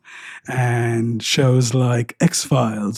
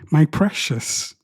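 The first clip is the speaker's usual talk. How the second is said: mock Gollum; 3 semitones higher, 3 dB quieter, slower than usual. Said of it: "I would rather do these accents".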